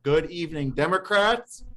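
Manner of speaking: high, rising call to the room; customary meeting greeting. Real, not acted. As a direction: greet the crowd brightly, lifted at the end. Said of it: "as we always start".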